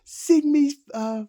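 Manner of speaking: high, loud, slow mocking demand; taunting inmate. Inmate demands a nursery rhyme, high loud taunt. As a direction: drag the song title out high and loud. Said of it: "That was the one".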